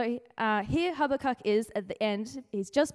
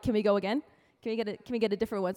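rushed mock plea; 3 semitones lower, much faster than usual. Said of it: "you're like".

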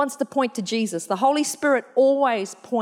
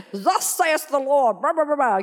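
hammed-up prophet voice; 4 semitones higher than usual. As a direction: ham it up like an old-time prophet. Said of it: "Can you imagine?".

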